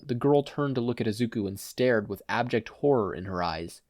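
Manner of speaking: ordinary delivery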